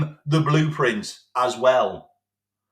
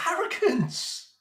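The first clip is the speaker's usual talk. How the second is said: slow, much higher, disbelieving scorn; higher scornful repeat; 11 semitones higher, 5 dB quieter, slower than usual. Real, not acted. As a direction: repeat it higher, dripping with contempt. Scornful verdict on the manager.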